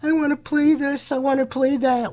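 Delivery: high whiny kid voice mocking shoppers; mocking whiny customer. DJ mocks customers craving guitars, high whiny voice. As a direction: whine it like a greedy kid. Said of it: "in your little itty-bitty brains".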